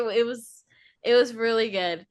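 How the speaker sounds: normal talking voice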